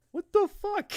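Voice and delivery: mock shriek, very high and loud; outraged shriek. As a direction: screech it in hammed-up disbelief. Roserade's acted outraged reaction.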